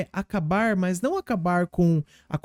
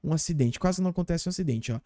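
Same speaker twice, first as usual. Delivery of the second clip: ordinary delivery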